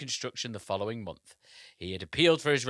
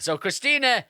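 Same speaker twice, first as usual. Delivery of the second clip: usual speaking voice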